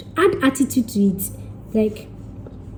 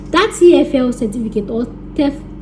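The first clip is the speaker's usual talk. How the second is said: normal talking voice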